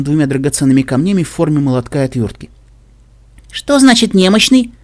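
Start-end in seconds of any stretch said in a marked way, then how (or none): 3.5-4.7 high, loud, slow chirp; chirping child. A narrator's reading. Curious child watching the adults, chirping her question. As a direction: chirp it like a curious little girl. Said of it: "защебетала Сюрприз".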